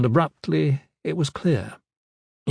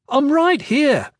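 higher, slower, pressing the point; insistent repeat; 4 dB louder, slower than usual. Acted. Kip insisting a second time that he's here, louder. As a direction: say it again so they finally hear. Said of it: "Kip said".

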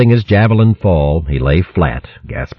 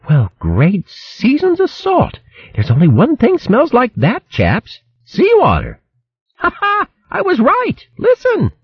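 very high, jolly hare chatter; excited hare voice. A narrator's reading. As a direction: crow with delight.

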